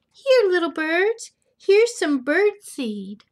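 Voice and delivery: loud, singled-out call, starting high. Acted. Owner offers little birds seed, calling out loudly. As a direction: call the birds over to eat.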